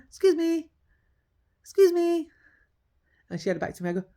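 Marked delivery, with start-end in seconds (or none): 0.1-2.3 calling out. Retold or imitated. Calling to a woman facing away.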